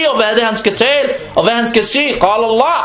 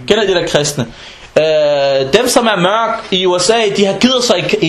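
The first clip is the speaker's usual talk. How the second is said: usual speaking voice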